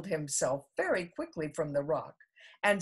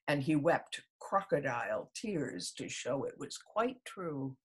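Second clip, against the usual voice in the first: plain reading voice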